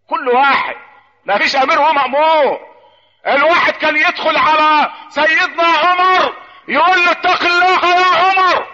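slow preaching rising to a high cry; building sermon delivery. Acted. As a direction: start measured, swell into drawn-out high proclamation. Preacher rising to full force. (خطبة تتصاعد بصوت ممدود عالٍ)

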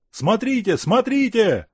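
excited high shout, pointing; calling out. Acted. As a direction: call out over the crowd. Onlookers calling out at the salute.

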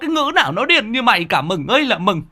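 high, fast furious scolding; an old neighbor shrieks at the madwoman; shrieking scold. A narrator's reading. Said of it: "Bà rít lên".

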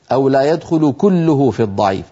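ordinary talk, nothing marked

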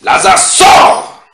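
huge high shout, long drawn out; loud cry. Retold or imitated. Preacher as Jesus calling Lazarus out, loud cry. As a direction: bellow the command to the dead. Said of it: "il cria d'une voix forte".

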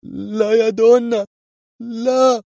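very high, drawn-out "no"; loud wail of pure panic; terrified scream. A narrator's reading. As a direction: cry out for your life. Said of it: "وصرخ".

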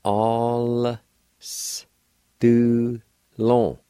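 "All s do long" in separate drawn-out pieces, L joined to the first vowel; teacher's slow breakdown of "All s do long", silent I; sounded out. Shown as a demonstration. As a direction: stretch and separate each part of "All s do long". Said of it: "pronounce the L sound with the A sound like this".

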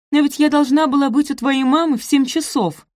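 high, loud anxious complaint; flustered protest. A narrator's reading. Sophie late for his mother's, flustered protest. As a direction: protest in a panic about being late.

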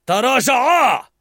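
big, loud, slow bellowed order; angry character roaring at the girls to stand back; furious shout. Acted. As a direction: bark them back before throwing. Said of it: "فصاح غضب".

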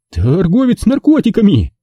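high-pitched cry of alarm; horrified exclamation. A narrator's reading. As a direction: blurt it out in horror. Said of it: "выкрикнул это вслух".

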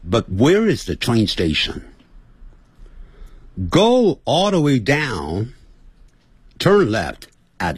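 "where is the train station" in an American accent; relaxed American-accented question. Shown as a demonstration. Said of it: "还是改成美式腔".

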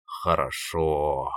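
low, soft, long-drawn relish. A narrator's reading. Koschei has just downed a glass, drawling contentedly. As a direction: stretch the word out slowly after a drink. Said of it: "потянул он".

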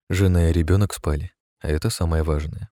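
ordinary delivery, nothing marked

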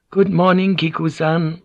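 high-pitched answering hello; echoed greeting. A narrator's reading. Mother echoes her son's greeting, high and polite. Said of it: "she echoed".

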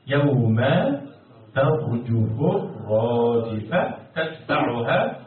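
slow chanted recitation with long-held vowels; Quran recitation. Shown as a demonstration. Teacher reciting the verse for students, slow measured chant. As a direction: recite in measured, drawn-out tones.

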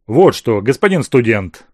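loud, snapping at the visitor; bare teeth and snap it out; snarling. Acted. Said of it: "окрысился Азеф".

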